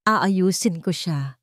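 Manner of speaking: ordinary delivery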